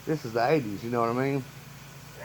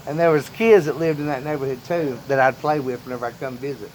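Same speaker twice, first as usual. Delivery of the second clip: ordinary talk, nothing marked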